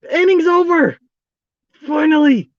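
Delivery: high-pitched, relieved outburst; exasperated relief. Real, not acted. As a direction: let out real relief that it's over. Long bad inning ends at last.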